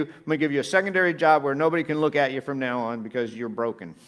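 a hint of a dismissive boss demoting him; mock Jesus voice. Preacher voicing a wrong Jesus, loud mock sarcasm. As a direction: play it as a sarcastic what-Jesus-didn't-say. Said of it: "Jesus could have said".

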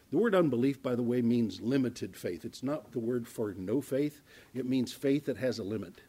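usual speaking voice

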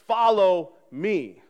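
preacher giving Jesus's order as a slow command. Shown as a demonstration. Slow commanding hey follow me. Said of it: "he's also commanding us".

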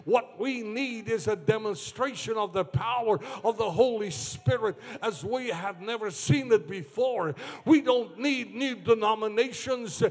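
high, driving cadence building to a loud shout; full-force preaching. Real, not acted. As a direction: hammer the repetitions, climbing to a roaring climax.

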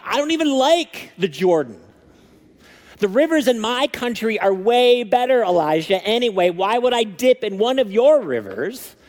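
mock rage. Pastor paraphrasing Naaman's rant at the prophet, mock angry. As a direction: play him stomping off in a huff. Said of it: "went off in a rage".

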